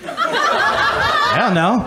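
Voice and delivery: very high, very slow drawn-out squeak; mock squeak. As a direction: stretch it up into a squeal. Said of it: "drawn-out high squeak".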